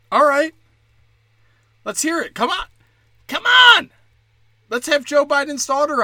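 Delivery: high, rising to a loud shout; excited shout. Pretend. Comic urging Biden's daughter on, building to a shout. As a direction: whip up the guest. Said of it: "egging on a guest".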